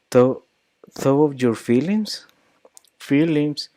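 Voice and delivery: "feelings" alto y marcado; stress on feelings. Shown as a demonstration. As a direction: sube el tono en "feelings". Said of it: "raised the pitch on the feeling".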